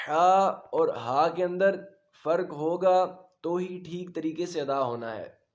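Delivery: ordinary talk, nothing marked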